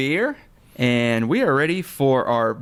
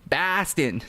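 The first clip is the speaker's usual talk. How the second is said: normal talking voice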